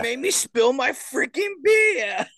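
high, shouted Boston outrage; Boston accent. Acted. Imitating Boston people cussing, shouted Boston accent. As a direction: yell it slowly like an angry Bostonian. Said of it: "So do Boston people".

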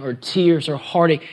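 normal talking voice

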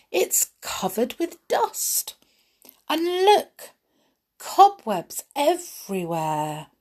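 high, drawn-out dismay at the mess; dismayed exclaiming. Acted. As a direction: exclaim over each new mess, pitched high.

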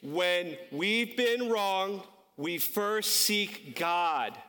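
extremely slow, stretched group recitation; deliberate, drawn-out chant-like pace; leading a recitation. Shown as a demonstration. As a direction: draw out every word so all can join in. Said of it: "now you're gonna say it with me".

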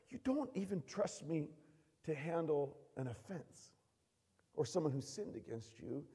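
soft, measured correction; quiet reproof. Acted. The Lord answering a worked-up believer, quiet reproof. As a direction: drop the volume and reason with them.

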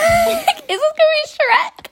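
high, slow incredulous question; dawning amused realization; amused disbelief. Real, not acted. Realizing the ogre is Shrek.

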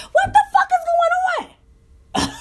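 shouted very high through laughter; laughing shout. Real, not acted. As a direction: explode in laughing disbelief. Outburst at disrespectful host.

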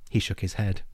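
ordinary talk

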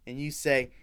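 usual speaking voice

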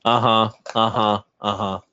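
ordinary talk, nothing marked

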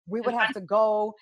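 ordinary delivery

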